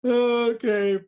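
put-on high loud kid's okay; kid with speech impediment. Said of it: "Go on, ask him".

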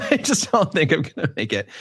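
laughing through the words. Real, not acted. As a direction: giggle while saying it. Laughing at own prediction.

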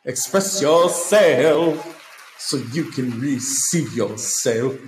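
slow, put-on diva delivery; mock diva. Comedian posing as a diva preaching to fans, slow. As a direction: croon it like a pop diva.

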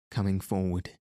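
ordinary delivery, nothing marked

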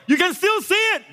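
high-pitched, taunting devil yell; devil's yell. Acted. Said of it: "And devil yells".

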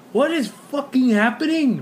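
baffled mock panic; retold disbelief. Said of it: "And I was like".

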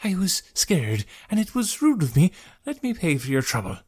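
high, meek, hesitant voice; apologetic traveller. A narrator's reading. Scared traveller offering payment to rescuer, apologetic.

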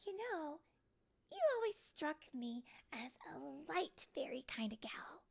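high-pitched, tactful fairy voice; sighing fairy. Acted. Silver Mist steering Tink toward light talent, sighing. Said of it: "Silver Mist sighed".

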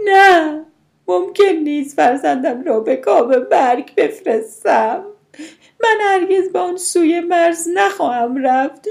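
high, loud cry from the heart; desperate scream. A narrator's reading. Mother refusing to send her baby to death, screaming. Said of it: "چنان از ته دل فریاد کشید".

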